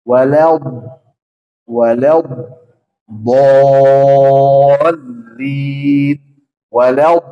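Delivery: Walal loud, the l held long; lengthened lam. Shown as a demonstration. Teacher drilling the correct lam. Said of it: "harus ada istitalah".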